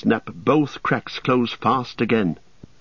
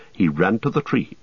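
plain reading voice

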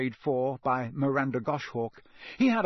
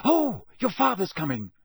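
sudden higher exclamation; 7 semitones higher, 5 dB louder than usual. A narrator's reading. Mother spotting the clock, sudden surprise. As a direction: blurt it out, surprised. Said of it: "she said suddenly".

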